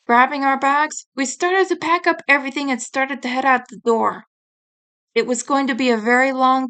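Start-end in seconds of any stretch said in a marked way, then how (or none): none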